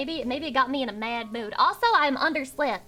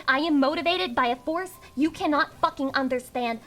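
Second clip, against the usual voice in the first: ordinary talk, nothing marked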